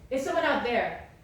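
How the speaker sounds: acted angry king calling servants. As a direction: shout for the guards outside.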